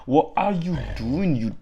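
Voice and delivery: high, swooping singsong question; playful pet-talk. Pretend. Owner teasing Sora, playful pet-talk.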